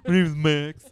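a mild caricature of a friend; hint of a goofy friend introducing himself; silly Max voice. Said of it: "I don't know why I made you sound like that".

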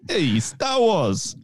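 slow, halting Walken voice; Christopher Walken impression. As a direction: drag it out like Walken. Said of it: "You doing Christopher Walken?".

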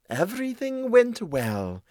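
high, slow, drawn-out cat voice; self-satisfied cat. Acted. Cat reporting on the day, slow and drawn out. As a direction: stretch it out smoothly like a cat.

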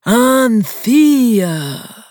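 high, loud snooty drawl on Anthea; mock the name like a posh country club snob. A narrator's reading. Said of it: "he repeats in a country club drawl".

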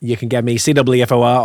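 ordinary talk, nothing marked

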